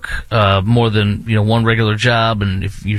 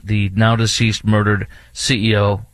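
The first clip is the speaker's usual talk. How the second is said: usual speaking voice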